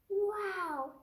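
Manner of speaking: amazed wow. Acted. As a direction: gasp it out in wonder. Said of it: "said Lily".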